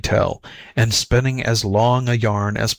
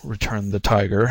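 ordinary delivery, nothing marked

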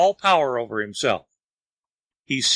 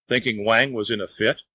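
plain reading voice

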